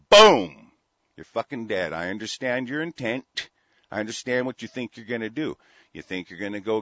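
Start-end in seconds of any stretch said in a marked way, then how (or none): 0.1-0.7 loud imitated gunshot burst. Host acts out shooting the officer with a loud boom. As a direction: bark out a loud, high boom like a pistol.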